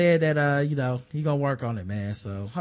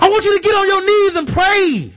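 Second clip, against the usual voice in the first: much higher, much louder preacher yell; Ray Lewis shout; 12 semitones higher, 9 dB louder than usual. Pretend. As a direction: bellow the command to kneel. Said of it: "Ray Lewis, go ahead and talk to him".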